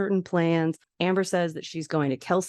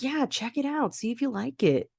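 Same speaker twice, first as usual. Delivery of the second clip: encouraging dad; 7 semitones higher than usual. Retold or imitated. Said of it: "And Crosby's like".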